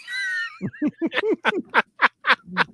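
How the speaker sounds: high, loud shrieking laugh; big squealing laugh. Real, not acted. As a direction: burst out cackling. Laughing at own joke.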